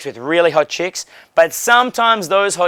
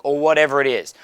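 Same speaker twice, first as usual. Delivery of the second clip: ordinary talk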